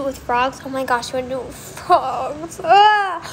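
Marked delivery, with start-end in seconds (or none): 2.6-3.2 short, high and loud yelp of disgust; a sudden squeal. Real, not acted. Reacting to frog dissection.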